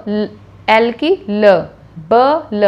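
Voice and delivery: slow separate sounds ब and ल, each on its own; separate letter sounds. Shown as a demonstration. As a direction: say ब and ल one at a time, not joined. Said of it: "अलग-अलग individual sounds". (ब और ल अलग-अलग धीरे बोलें)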